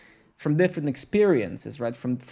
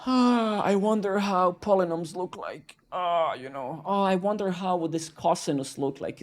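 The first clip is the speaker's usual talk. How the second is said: mock dreamy nerd; 4 semitones higher than usual. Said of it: "I'm like".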